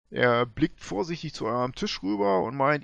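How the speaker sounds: normal talking voice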